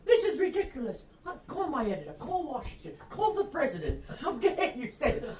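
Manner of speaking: high, loud, outraged rant with bursts of laughter; raving indignation. Retold or imitated. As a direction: demand your rights, half laughing. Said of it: "I started raving and ranting".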